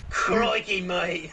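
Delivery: hammed-up Aussie bushman; mock Australian accent. Friend does an outback bloke in mock Australian accent. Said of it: "That's just Australian way".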